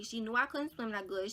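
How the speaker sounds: normal talking voice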